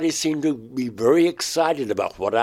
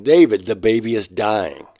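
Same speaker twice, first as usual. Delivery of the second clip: retold alarm, raised and louder; urgent warning; 8 dB louder than usual. Crescent telling David the baby is dying, urgent. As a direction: deliver bad news urgently, a bit louder.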